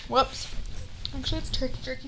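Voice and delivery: chewing while talking; words round a bite of food; mouth full. Real, not acted. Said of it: "Enjoy [name] chewing on an apple".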